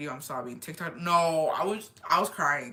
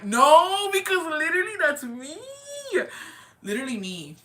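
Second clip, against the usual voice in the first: excited recognition; 10 semitones higher, 6 dB louder than usual. Real, not acted. Relating to a viewer's comment.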